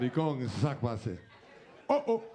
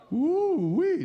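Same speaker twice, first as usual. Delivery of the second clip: much higher, loud woo-wee; excited whoop; 7 dB louder than usual. Real, not acted. Host whooping at his own joke, loud and high. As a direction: whoop it out in delight.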